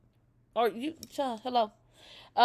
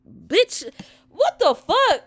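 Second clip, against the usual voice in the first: shocked outburst; 11 dB louder, slower than usual. Real, not acted. Shocked reading the illegible note.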